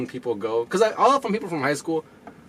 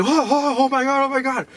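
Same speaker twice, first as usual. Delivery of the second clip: high-pitched mock panic; imitated alarm; 11 semitones higher than usual. Onlookers seeing a girl faint on the stairs, imitated alarm. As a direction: cry out like a startled onlooker, acted.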